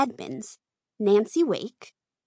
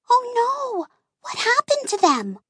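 usual speaking voice

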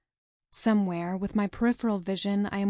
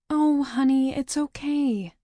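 smiling comfort; 5 semitones higher, 4 dB louder than usual. Acted. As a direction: soothe a crying little sister. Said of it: "I smile".

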